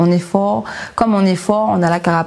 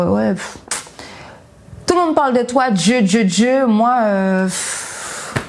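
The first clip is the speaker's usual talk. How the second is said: put-on dismissive puffs, retelling old insolence; arrogant, insolent; 4 semitones higher than usual. Younger self talks up to the sky, insolent. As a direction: mimic your cocky younger self brushing it off. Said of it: "j'étais très arrogante, très insolente".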